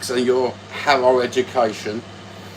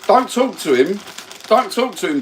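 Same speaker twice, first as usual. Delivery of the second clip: mock telling off; 8 semitones higher, 4 dB louder than usual. Real, not acted. Scolding co-host on stream.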